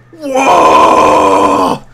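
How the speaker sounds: long, low roar held on one level note; loud orc ship roar. Acted. Game master sounds the orc ship's attack, loud low roar. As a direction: bellow out a deep, drawn-out war cry. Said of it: "the orc ship lets out a loud".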